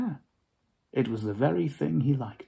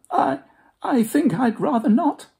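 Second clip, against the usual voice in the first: much higher, quivering faltering reply; frightened Scrooge; 12 semitones higher, 4 dB louder than usual. Acted. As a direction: stammer out the refusal.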